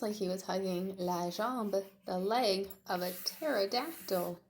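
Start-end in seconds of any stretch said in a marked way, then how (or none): none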